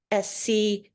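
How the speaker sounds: usual speaking voice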